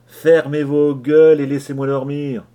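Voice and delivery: angry rant. Acted. Leach berates the frightened crew.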